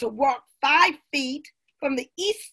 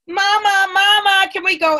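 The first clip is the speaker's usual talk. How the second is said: high, loud excited call; eager child pleading; 7 semitones higher, 8 dB louder than usual. A narrator's reading. Amma asking her mother to let her go, pleading. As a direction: beg Mama eagerly, voice lifted.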